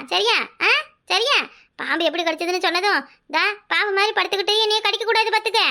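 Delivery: usual speaking voice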